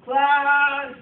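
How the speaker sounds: loud call across the room; teacher calling a noisy class. Shown as a demonstration. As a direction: call out for attention. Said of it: "let's practice our class yes".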